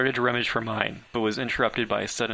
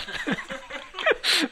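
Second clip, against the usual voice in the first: stifled high laugh; high burst of laughter. Real, not acted. Reader laughs mid-sentence. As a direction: laugh breaking out.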